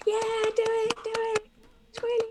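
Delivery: high goblin chant urging him on; goblin crowd cheering. Acted. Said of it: "the crowd just gets more and more like".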